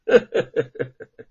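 self-satisfied villain's laugh; gloating laugh. Acted. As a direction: let out a pleased, nasty laugh. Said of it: "幸灾乐祸地说".

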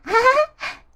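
put-on high squealing child's laugh; childish laugh. 小苹果 laughing with 豆豆 under the tree, high delighted laugh. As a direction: let out a happy kid's laugh.

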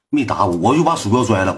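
plain reading voice

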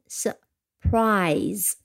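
surprise读得很慢，ur是短音; slow pronunciation demo. Shown as a demonstration. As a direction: say surprise slowly with a short ur. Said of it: "但是它是个短音". (慢读surprise，ur发短音)

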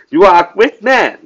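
mildly mocking compliment; a touch of insincere praise; sarcastic. Shown as a demonstration. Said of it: "但是我讽刺地说". (略带讽刺)

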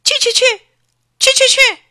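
high, loud cries of go away; boy yelling at the echo to go away; angry shooing. A narrator's reading. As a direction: shoo the pest off in a temper. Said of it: "小峰生气了".

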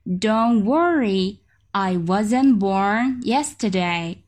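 very slow, calm reassurance; slow teacher demo; slow model reading. Shown as a demonstration. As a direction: say it slowly to calm parents. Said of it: "slow model reading".

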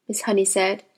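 plain reading voice

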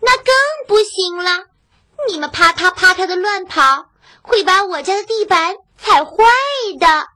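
high, loud, quick shout. Acted. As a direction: yell it with a stubborn shake. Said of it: "大声地叫道".

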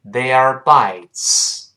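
"They are bites" very slow, full vowels; slow reading. Shown as a demonstration. Teacher leads learners slowly first, slow reading. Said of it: "跟老师先慢慢读一下".

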